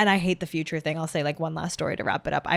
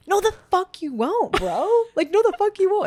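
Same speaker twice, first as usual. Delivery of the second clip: high, loud mock outrage; sassy, high-pitched pushback; indignant retort; 12 semitones higher, 6 dB louder than usual. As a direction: act out an incredulous refusal. Acting out her indignant reaction to date.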